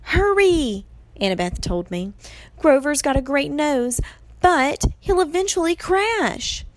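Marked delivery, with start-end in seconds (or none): 0.0-0.9 high, loud prod; urgent command. A narrator's reading. Annabeth tells Percy to act, urgent and loud. 2.6-6.6 high-pitched, pressing explanation; lightly voiced girl urging haste; urgent Annabeth. A narrator's reading. Annabeth explains the danger to Percy, urgent and high.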